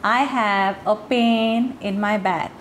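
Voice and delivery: "pain" with a long ay vowel, "back" for bag, said very slowly; slow deliberate wrong vowel in "pain"; mispronounced pen as pain. Shown as a demonstration. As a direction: stretch "pain" into a long ay sound. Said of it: "अगर एह का sound मैंने एह करके नहीं pronounce किया".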